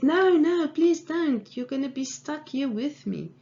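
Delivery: high mock-panicked protest, loud at the start; alarmed pleading. Trapped man warns rescuer not to climb down, mock panic. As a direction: act out begging someone to stop.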